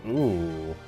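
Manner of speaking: ordinary talk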